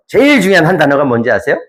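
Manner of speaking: normal talking voice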